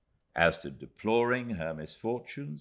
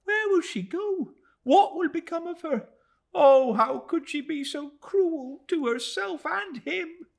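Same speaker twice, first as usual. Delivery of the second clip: sobbing Minnie; 9 dB louder than usual. A narrator's reading. Minnie grieving for Emily, high loud sobbing. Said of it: "sobbed Minnie".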